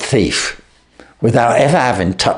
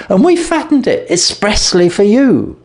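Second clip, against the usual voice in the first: sister's voice; 11 semitones higher than usual. A narrator's reading. Sister voiced in retold tale.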